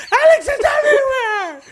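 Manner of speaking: very high, loud wailing shriek; panicked screaming. Retold or imitated. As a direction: wail to a friend in hammed-up panic. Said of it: "in panic".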